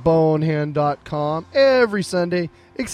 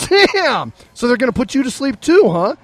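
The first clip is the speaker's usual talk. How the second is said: astonished reaction; 7 semitones higher, 6 dB louder than usual. Acted. Two pound dogs react to the confession, astonished. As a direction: play the shocked dog, voice jumping up.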